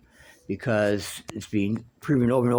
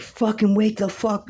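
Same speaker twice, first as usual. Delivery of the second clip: much higher, slower, desperate yell; yelling at mother; 12 semitones higher, slower than usual. Retold or imitated. Son at mother's hospital bed, desperate retold yell. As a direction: shout her awake. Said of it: "I'm like".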